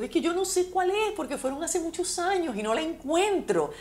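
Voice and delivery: high, tearful plea to the stranger; tearful pleading. Retold or imitated. Telling the cyclist she can't find grandfather's house, tearful. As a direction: voice pinched up by tears. Retells herself crying, lost house.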